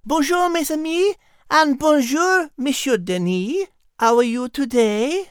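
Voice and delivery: put-on light French-accented cat voice; cheerful French lady greeting friends. Liz greets everyone in a French accent.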